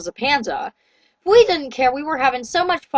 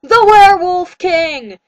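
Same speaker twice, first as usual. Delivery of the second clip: slow, loud proclamation, higher at the end; yelled; 5 semitones higher, 8 dB louder, slower than usual. A narrator's reading. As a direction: announce the title with a roar. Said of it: "he yelled".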